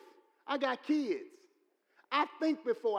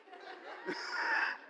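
a sudden high squeal of laughter; real laugh breaking through the sermon; 4 dB quieter than usual. Real, not acted. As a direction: burst out laughing at your own joke. Laughing at his own joke.

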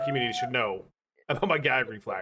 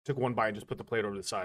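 ordinary delivery

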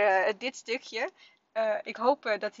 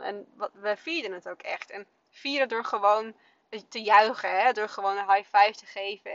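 ordinary talk

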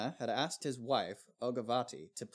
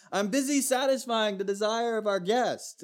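breezy wife reply; 10 semitones higher, 5 dB louder than usual. Retold or imitated. Wife voiced in retold story.

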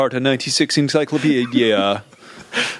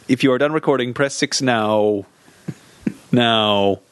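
slow robotic prompt. Pretend.

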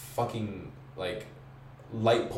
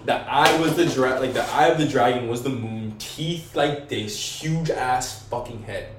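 ordinary talk

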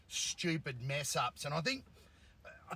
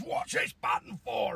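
raised, louder, slow comic question; imitating TV character; 6 semitones higher, 4 dB louder, slower than usual. Host retelling a TV comedy gag, imitating the character. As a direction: play the button-pusher, slow and loud. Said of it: "He goes".

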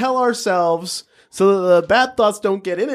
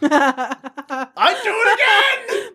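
high, shouted, slowed and laughing; mock defiant shout; 13 semitones higher, much slower than usual. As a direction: belt it out like a gleeful crook. Mocking the monkey thief.

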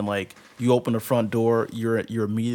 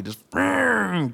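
much higher, punchy boom; imitated boom; 11 semitones higher, 4 dB louder than usual. Describing the uncle catching up, punchy boom. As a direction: pop it out like a quick leap. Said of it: "sound effect".

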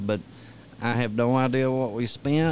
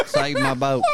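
laughing; 3 dB louder than usual. Real, not acted. Laughing about being rescued.

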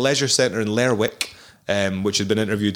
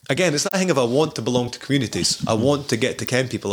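usual speaking voice

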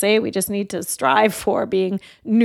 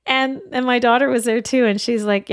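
usual speaking voice

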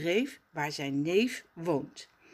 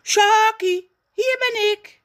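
much higher, loud happy call; enthusiastic shout; 10 dB louder than usual. Acted. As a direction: shout across to the cousin. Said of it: "gilt Raf enthousiast".

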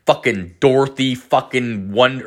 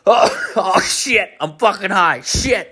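panicked realization; 9 semitones higher, slower than usual. Retold or imitated. Panicking when friends call.

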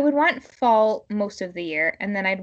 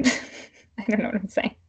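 laughing; 4 semitones higher, 4 dB louder than usual. Real, not acted. Laughing at her own rambling.

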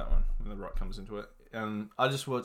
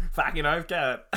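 loud, higher hammed-up Aussie; real Aussie; 6 semitones higher, 6 dB louder, slower than usual. Shown as a demonstration. Trading Aussie slang for laughs, loud broad Aussie. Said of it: "That's real Aussie".

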